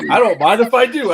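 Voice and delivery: loud, high, laughing mock politeness; loud laughing fake-polite reply; laughing quote. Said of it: "It's like".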